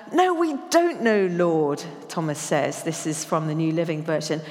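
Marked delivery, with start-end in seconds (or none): 0.1-1.8 high, loud exasperated outburst; exasperated blurting. Acted. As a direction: blurt it out, fed up. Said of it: "I can hear him blurting it out".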